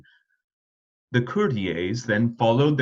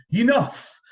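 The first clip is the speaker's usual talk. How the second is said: higher, louder cry of enough; cried out; 10 semitones higher, 5 dB louder than usual. A narrator's reading. King worn out from dancing, crying out. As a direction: call a halt, exasperated. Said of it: "the king cried".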